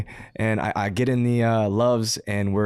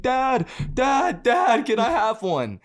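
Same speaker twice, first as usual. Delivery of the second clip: put-on high, slow whiny child voice pleading with dad; begging little kid; 13 semitones higher, much slower than usual. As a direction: play a small boy begging his father for a toy. Drunk friend begging like a child.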